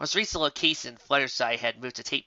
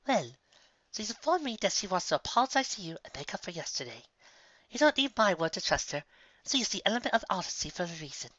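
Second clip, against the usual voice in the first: higher, coaxing lady voice; smooth her friend's nerves, higher; Rarity, friendly; 6 semitones higher, 4 dB quieter than usual. Acted. Rarity calming Sandalwood about Applejack, higher and coaxing. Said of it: "Rarity continued".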